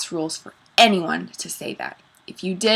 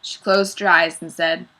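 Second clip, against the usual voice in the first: usual speaking voice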